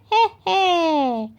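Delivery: mock witch cackle. A narrator's reading. Peppa dressed as a witch, cackling. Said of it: "cackled Peppa".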